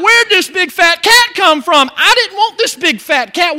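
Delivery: loud, high-pitched bewildered protest; mock indignation. Owner finds a fat cat on the lap, loud mock indignation. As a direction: protest loudly as if baffled and put out. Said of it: "And you're gonna be like".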